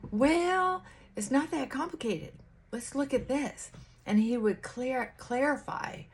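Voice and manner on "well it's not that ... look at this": imitating Charlie Kirk. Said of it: "Charlie Kirk was able to say".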